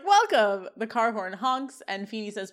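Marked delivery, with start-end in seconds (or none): 0.0-0.7 louder, higher smug greeting; teen sitcom quip voiced with glee; smug welcome. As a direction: land the word like a sly joke. Voicing Sean's quip.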